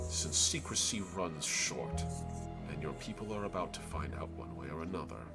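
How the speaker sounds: a patient ancient tree rumbling. Acted. Tree speaks at length.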